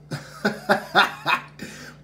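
high, breathy giggling run; rueful laugh. Real, not acted. Opener admitting the card won't help, rueful laugh. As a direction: laugh it off helplessly.

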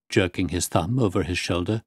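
ordinary delivery

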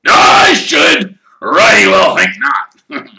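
loud, very slow high gruff shout; growled. Acted. Hagrid scolding the boys for wanting Knockturn Alley, a slow loud growl. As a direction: scold them firmly. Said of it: "growled Hagrid".

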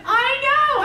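very high and loud; thrilled reply. Retold or imitated. Husband answering wife, thrilled, very high and loud. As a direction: gush with joy. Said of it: "He said".

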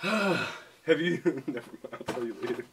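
normal talking voice

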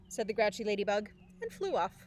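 usual speaking voice

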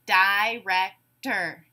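"director" split into separate syllables, di-rec-tor. Shown as a demonstration. Teacher giving a word to blend, said syllable by syllable. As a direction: break "director" into slow, separate beats. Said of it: "say the syllables in a word".

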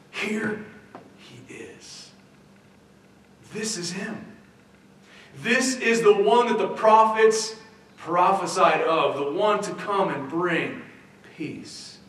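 rising high and loud, then a hushed whisper; awed excitement. Retold or imitated. Onlookers at the manger marvelling at the promised child. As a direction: announce him with wonder. Onlookers greeting the newborn Christ.

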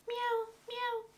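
high, quiet mewing like a little kitten; high soft kitten meow. Pretend. Teacher pretends to be a kitten for the child, soft high meow. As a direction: meow softly like a tiny kitten. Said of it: "fingir que somos gatitos".

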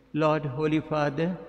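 usual speaking voice

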